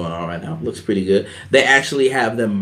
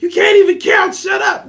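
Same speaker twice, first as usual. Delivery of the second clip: much higher, loud mock shout; hammed-up angry shout; mock yell; 4 dB louder than usual. Kevin Owens yelling, voiced.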